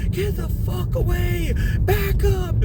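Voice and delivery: very high-pitched mock yell; imitated warning cry. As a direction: mimic a wife fending off an attacker. Wife warns off the attacker.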